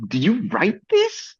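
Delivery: high, slow, disbelieving question; stretched-out, high-pitched incredulity; incredulous. Retold or imitated. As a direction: challenge the writer in drawn-out disbelief. Mock challenge to the film's writer.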